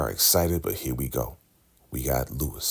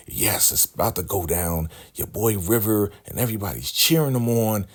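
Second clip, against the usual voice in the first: ordinary talk, nothing marked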